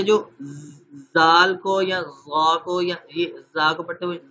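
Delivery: usual speaking voice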